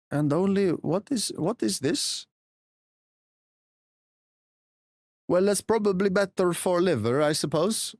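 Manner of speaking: put-on Swedish accent. Streamer doing redeemed accent for viewers, Swedish accent. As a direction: speak English with a lilting Swedish accent. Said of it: "in my lovely Swedish accent".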